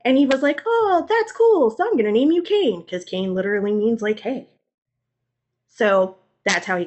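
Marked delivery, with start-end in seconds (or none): 0.7-2.8 mock-cheerful little kid naming himself; newborn kid voice. Newborn Cain to his mama, high and chirpy.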